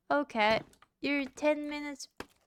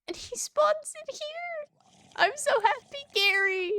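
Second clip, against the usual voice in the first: much higher, slow, gleeful; delighted squeal; 14 semitones higher, 4 dB louder than usual. Real, not acted. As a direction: squeal with joy at the new house. NPC spawns in the built house.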